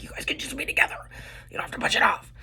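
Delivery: breathy whisper, urging the couple on; exasperated whisper to the characters; whispered plea. Retold or imitated. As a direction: whisper it like pleading at the screen.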